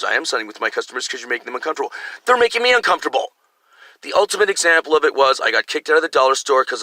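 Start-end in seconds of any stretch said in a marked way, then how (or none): 0.0-1.9 low, soft, rapid retold reply; lightly voiced shop employee, hurried and low; shop employee's retort. Staff's reply in retold argument. 2.2-3.3 high, quick protest; mock-exasperated comeback; retold indignant comeback. Own comeback in retold argument.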